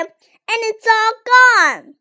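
put-on high, loud baby bear wail; wee Baby Bear. A narrator's reading. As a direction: cry out like a tiny upset cub. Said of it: "cried wee Baby Bear".

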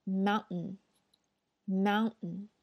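very slow mountain, T held, nasal end. Shown as a demonstration. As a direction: stretch mountain, swallow the T. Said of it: "这个要读".

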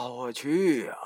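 ordinary delivery, nothing marked

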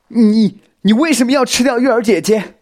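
very high, loud accusation; brave loud shout. A narrator's reading. Xiao Xin summoning courage to accuse spider, loud shout. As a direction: stammer the first word, then yell. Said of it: "小新鼓起勇气也大声吼道".

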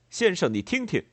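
indignant. A narrator's reading. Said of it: "列莫尔说道".

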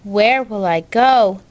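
plain reading voice

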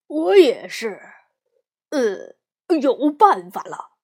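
lightly voiced big papa bear; a hint of a hearty bear dad.

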